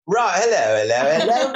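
high, jokey British greeting; British accent. Pretend. Guest pretends to meet soap castmates in a playful British accent. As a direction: put on a bright British accent for a laugh. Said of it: "And I'll be British".

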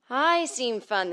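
stretched, sustained vocal-exercise delivery; voice-coach correct speech. Shown as a demonstration. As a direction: glide into "I seem fun" as a trained voice would. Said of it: "teach us how to talk correctly".

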